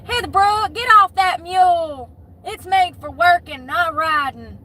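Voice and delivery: high, loud country mom voice; Southern parent scolding. Retold or imitated. A parent scolding the daughter off the mule, high and loud. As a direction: holler at her off the mule. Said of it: "You sound like me".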